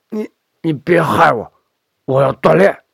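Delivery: put-on halting, accusing old man; paranoid old father. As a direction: stammer out the accusation.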